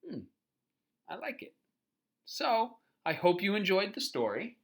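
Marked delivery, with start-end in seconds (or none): none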